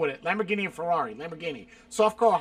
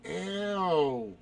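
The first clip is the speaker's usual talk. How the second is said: long, softer groaning ew; drawn-out disgust; 5 dB quieter than usual. Real, not acted. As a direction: stretch it out, grossed out. Disgusted by mushrooms or Twinkies.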